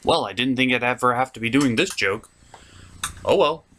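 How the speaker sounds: ordinary talk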